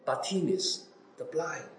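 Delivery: ordinary talk, nothing marked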